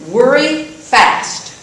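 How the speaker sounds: normal talking voice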